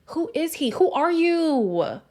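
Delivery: high-pitched, incredulous questioning, drawn out at the end; exasperated disbelief. Real, not acted. Fed-up viewer demanding to know who Chris is, incredulous. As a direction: build to a slow, baffled demand. Exasperated rant about baffling contestant.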